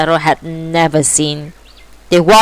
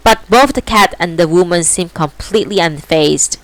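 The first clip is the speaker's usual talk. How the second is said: ordinary delivery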